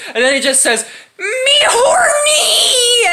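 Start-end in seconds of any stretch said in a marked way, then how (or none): 1.2-3.1 horny shout. Acted. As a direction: stretch out a high yelp of lust. Bruce Banner climaxing, before the Hulk.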